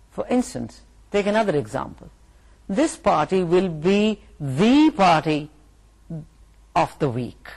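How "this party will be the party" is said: the party stretched out slowly; emphatic the. Shown as a demonstration. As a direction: punch the before party. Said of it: "you wanted to emphasize".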